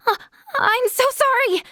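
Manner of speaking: high-pitched, mortified apology; embarrassed and apologetic; flustered apology. Acted.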